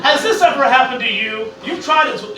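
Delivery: usual speaking voice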